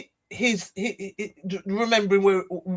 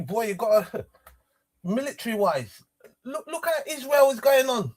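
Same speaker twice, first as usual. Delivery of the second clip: ordinary talk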